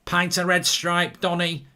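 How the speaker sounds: slow, swaggering Mancunian pub order; Manchester accent. Retold or imitated. Teenage self ordering from Donny, Manchester accent. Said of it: "I'd be like this".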